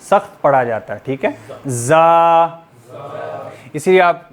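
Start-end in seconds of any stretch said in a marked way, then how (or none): 1.7-2.7 जा said hard, through pressed teeth; जा hard and firm between the teeth. Shown as a demonstration. Teacher showing the hard letter to the class. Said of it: "सख्ती के साथ दांतों को थोड़ा पीस कर".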